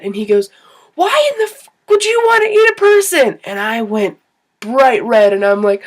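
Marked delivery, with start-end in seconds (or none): 1.0-3.4 much higher and louder, appalled disbelief; a friend on the bus exclaims in horror at her loud words, retold as a high, loud incredulous yell; incredulous outburst. Scene: friend on the bus reacting to her blurted thought, high loud appalled outburst.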